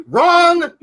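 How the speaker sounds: loud, high 'wrong' like a game-show buzzer; mock buzzer wrong. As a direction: call 'wrong' out loudly and playfully. Buzzer-style put-down of a mistake.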